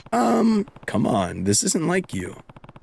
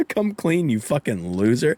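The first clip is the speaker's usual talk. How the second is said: ordinary talk, nothing marked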